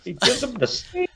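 very high, hammed-up Italian accent; mock Italian accent. Retelling the steak gift for laughs, mock Italian accent. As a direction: squeak it out in a fake Italian accent. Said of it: "It was Italian".

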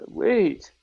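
high-pitched acted interruption; startled interjection. Iceman mid-fight, high mock-startled interjection. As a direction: pipe up high in mild alarm.

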